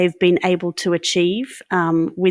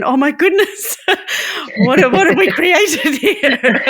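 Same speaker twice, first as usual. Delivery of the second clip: high-pitched, laughing disbelief; laughing mock alarm; 9 semitones higher, 4 dB louder than usual. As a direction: play overwhelmed, cracking up as you go. Acted overwhelmed alternative, with laughter.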